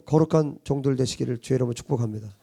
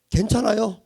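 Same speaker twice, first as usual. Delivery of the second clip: mock forced okay; 6 semitones higher, 3 dB louder than usual. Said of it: "하면서 뒤에 가서 우는 게 아니라".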